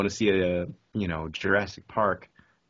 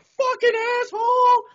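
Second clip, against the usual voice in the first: mock furious yell; 8 dB louder than usual. Playing the pedestrian nearly hit by the car, yelling. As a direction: imitate an outraged pedestrian yelling.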